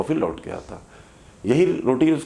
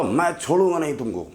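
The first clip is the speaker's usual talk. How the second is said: angry threat; 6 semitones higher than usual. Acted. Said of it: "उसको गुस्सा आया बहुत तेज".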